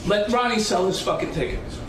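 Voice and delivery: ordinary talk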